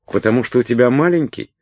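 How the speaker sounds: high, quick needling question; taunting question. A narrator's reading. Салли needles Уилт after his refusal, taunting. Салли язвительно допрашивает Уилта.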